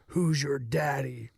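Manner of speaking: slow, low mock-seductive radio voice; creepy ad voice. Host imitating an old car ad, low and creepy. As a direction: draw it out low, imitating a cheesy ad. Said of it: "Like, like that".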